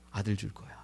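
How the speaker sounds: soft low promise. Acted. God's promise voiced softly.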